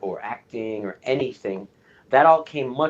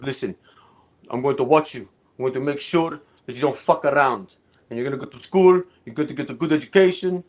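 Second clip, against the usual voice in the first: imitating his dad in a thick Argentine accent; a stern Argentine father warning his son, accent laid on thick; 2 semitones higher, 4 dB louder than usual. Dad laying down the law to his son, thick Argentine accent. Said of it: "in this thick Argentine a-, accent".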